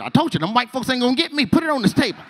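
high, fast, laughing bravado; Big Mix voice. Retold or imitated. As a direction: brag it like the cook.

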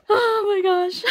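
high gasping exclamation; gasp, then exclaim high and overcome; nervous shock. Real, not acted. Fan nervous before seeing the card, high gasping shock. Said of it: "I'm scared".

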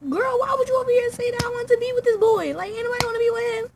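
high, loud rapid-fire outburst; outraged retort. Retold or imitated. Girl snapping back at Peach over the boy, outraged yell. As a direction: yell it in high-pitched indignation.

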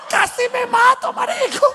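very high shout with a laugh; squealing laugh at own fall; laughing Venezuelan. Retold or imitated. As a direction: shrug off nearly dying, cracking up.

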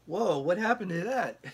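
high, loud "whoa" of disbelief; shocked. Retold or imitated. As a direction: blurt it out, stunned. Shocked at his own body.

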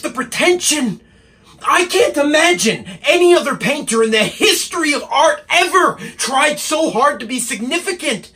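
high-pitched, exasperated ranting; angry tirade. Acted. Assistant berating the painter for his pretension, angry. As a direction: let the outrage spill out at him. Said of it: "he's quite angry".

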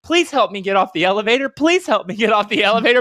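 a stuck robot begging on loop; pleading robot voice. Retold or imitated. Imitating the robot stuck spinning in the elevator, high and pleading. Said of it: "spinning in circles saying".

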